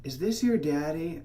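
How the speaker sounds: slow, slightly high imitation of the lady; stranger lady. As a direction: mimic a woman questioning a child, slowly. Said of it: "she's like".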